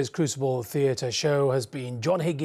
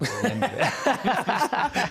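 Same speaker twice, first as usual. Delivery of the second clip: laughing; 10 semitones higher than usual. Real, not acted. Host laughs at joke.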